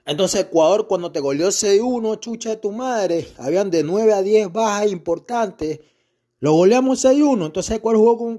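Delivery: angry rant yelled at the pundit; heated rant. Real, not acted. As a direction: shout him down about the six-one win. Ranting at Colombian pundit.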